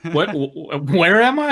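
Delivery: mock startled, pitching up into a loud squeak; confused. Mocking Drew who wandered in from another story, startled. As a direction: play a lost man suddenly jolted awake. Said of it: "Drew's like".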